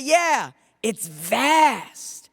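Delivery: high call with the last word stretched long; slow, drawn-out far-off shout. Acted. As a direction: holler it, drawing out "vast". Someone calls back across the river.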